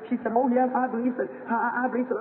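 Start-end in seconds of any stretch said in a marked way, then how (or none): 0.4-1.2 put-on eager sick girl. Dying girl tells the preacher she believes, high and pleading. 1.5-2.1 put-on stammering sick girl. Sick girl affirms her faith.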